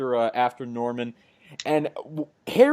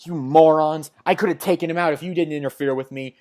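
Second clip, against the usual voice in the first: angry retort; 3 semitones higher, 7 dB louder than usual. Retold or imitated. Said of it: "Silver Sable's like".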